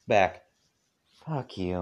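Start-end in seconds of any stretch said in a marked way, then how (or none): 1.2-1.8 soft, muttered under the breath; mumbled. A narrator's reading. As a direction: grumble it quietly, turned away. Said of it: "I mumbled".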